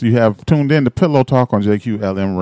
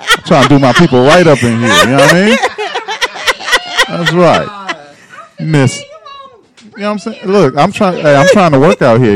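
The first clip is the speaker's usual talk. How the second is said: loud, higher hype man voice; 10 semitones higher, 6 dB louder than usual. Acted. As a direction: pump up the room like a hype man. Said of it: "need a hype man to come bring 'em in".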